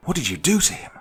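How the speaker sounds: high, loud accusing shout; high and loud, confronting the captor; acted shout. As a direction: yell it at her, demanding. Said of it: "I shouted".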